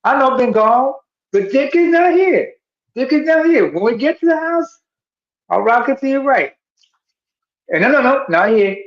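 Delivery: high concerned voice; voicing the boyfriend. Retelling the skit, boyfriend asks his girlfriend, high. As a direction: ask it high and worried. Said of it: "and he's like".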